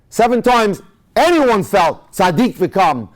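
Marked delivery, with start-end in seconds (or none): none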